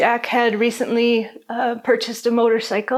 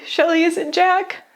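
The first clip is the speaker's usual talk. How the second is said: retold yell; 8 semitones higher than usual. Aunt calls to her sister asking about Jack, mild retold yell. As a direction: recount calling out, pitch well up without full volume. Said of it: "I yelled to Shelley".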